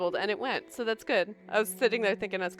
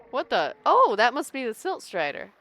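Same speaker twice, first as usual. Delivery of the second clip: ordinary talk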